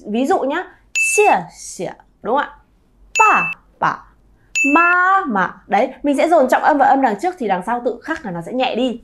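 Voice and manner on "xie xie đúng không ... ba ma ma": 前字重读 后字轻声; stress on first syllable. Shown as a demonstration. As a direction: punch the first, let the second fade. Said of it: "dồn trọng âm vào cái từ đằng trước".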